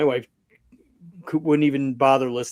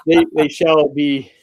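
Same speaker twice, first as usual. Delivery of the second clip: laughing; 4 semitones higher, 7 dB louder, slower than usual. Real, not acted. Joking about naming people.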